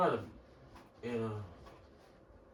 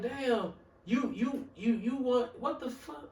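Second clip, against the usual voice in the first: higher, softer baffled stammering; stunned stammer; 5 semitones higher, 4 dB quieter, slower than usual. Retold or imitated. Customer told to hand over five cent, stunned stammer. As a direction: act out being lost for words. Retelling disbelief at blunt demand.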